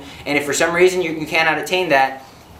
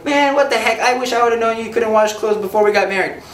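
higher, sarcastic dig; mock exasperated husband; 6 semitones higher than usual. Husband to wife in a laundry quarrel, mock sarcastic jab. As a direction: needle her with a snide jab.

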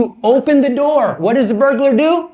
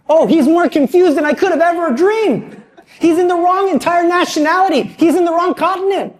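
higher, laughing shopper's voice; higher voice chuckling at a hopeless boy; amused older woman; 8 semitones higher than usual. Retold or imitated. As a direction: play the lady who can't believe how lost he is.

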